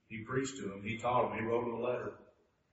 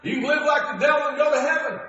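mocking objector; 10 dB louder than usual. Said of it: "Somebody might say".

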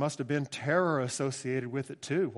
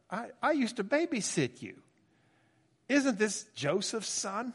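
higher, indignant hometown neighbor scoffing; offended townsfolk; 7 semitones higher than usual. Retold or imitated. Preacher voicing Nazareth townsfolk who resent Jesus, higher and indignant. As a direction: scoff at him like an old neighbor who knew him as a baby. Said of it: "more of a".

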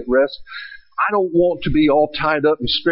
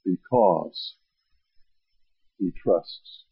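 slow gentle reading; 6 semitones lower, slower than usual. Shown as a demonstration. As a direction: let each phrase settle calmly. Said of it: "Listen to it".

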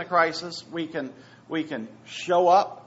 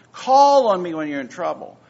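loud proclamation; 2 semitones higher, 11 dB louder than usual. Acted. Quoting God forcefully.

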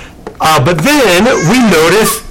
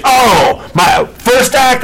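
higher, eager announcement; eager performer; 7 semitones higher, slower than usual. Acted. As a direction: show off the act proudly. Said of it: "And he's like".

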